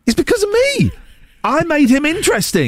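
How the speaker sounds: high-pitched, indignant self-credit; bitter and resentful. Real, not acted. Jealous former contestant claiming the credit, bitter and resentful. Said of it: "am I bitter and resentful?".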